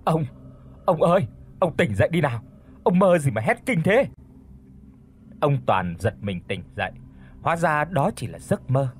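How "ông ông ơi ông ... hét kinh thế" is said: urgent, slightly raised wake-up call; calling out loudly. A narrator's reading. Wife waking her screaming husband, urgent calling. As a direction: call to rouse a sleeper, pitch lifting. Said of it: "gọi lớn".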